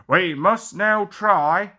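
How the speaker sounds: a boss scolding his engine; cross Fat Controller. A narrator's reading. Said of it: "said the Fat Controller crossly".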